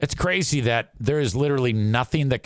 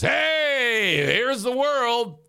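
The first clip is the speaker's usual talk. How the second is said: much higher, slow, grand mock reveal; spring it on him like a showman; mock announcement; 11 semitones higher, much slower than usual. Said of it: "rather than just".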